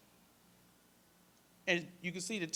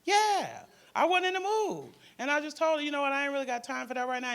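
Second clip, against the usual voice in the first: much higher and louder, fast at the end; heated teen; 8 semitones higher, 7 dB louder than usual. Retold or imitated. Teen girl retelling her blowup at the counselor, high and loud. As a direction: fire it off like a fed-up teenager.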